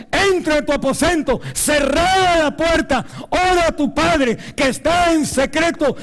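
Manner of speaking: high, loud, impassioned shouting; preaching at full force. Real, not acted. Preacher crying out to the congregation about private prayer. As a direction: preach it like a revival.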